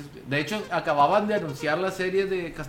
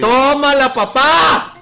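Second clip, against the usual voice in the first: excited triumphant shout, much higher and louder; yelling across the room; 9 semitones higher, 12 dB louder, much slower than usual. Real, not acted. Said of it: "Perdón por gritar".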